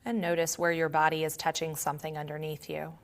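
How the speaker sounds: calm, quiet meditation voice with long pauses; guided meditation. Acted. Yoga teacher leading students to notice body and breath, calm guided meditation. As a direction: guide them gently inward, leaving long silences.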